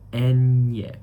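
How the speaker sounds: "enye" said loudly with a strong ny glide; inflected Spanish ñ. Shown as a demonstration. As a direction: model "enye" loudly for learners to repeat. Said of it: "you have to really inflect".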